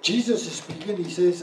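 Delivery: ordinary talk, nothing marked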